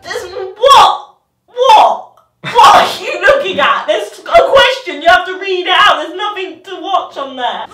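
usual speaking voice

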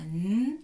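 N as a loud held hum; isolated letter sound. Shown as a demonstration. Teacher sounds out each letter, loud held consonant. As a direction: hold the N sound out. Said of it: "on les prononce chacune".

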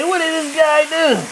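what did this guy do, in a hammed-up Italian accent; mock Italian. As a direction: ham up an Italian chef. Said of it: "The Italian's gonna say".

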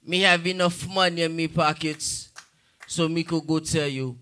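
put-on Jamaican accent. Jamaican man answering the judge, Jamaican accent. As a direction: sink steadily into a low Jamaican insult. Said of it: "Jamaican man was like".

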